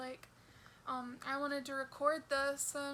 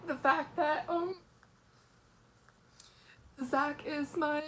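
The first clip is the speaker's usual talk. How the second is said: choked up, fighting tears; crying; 4 dB louder than usual. Real, not acted. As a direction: voice breaking with tears. Crying while describing illness.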